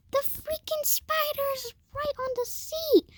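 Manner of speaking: very high squealed mom imitation; mock panic. Mom tells child where spider is, squealed mock panic.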